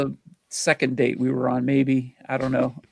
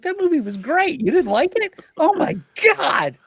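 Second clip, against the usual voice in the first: mock enthusiastic self; 3 dB louder than usual. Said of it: "And I was like".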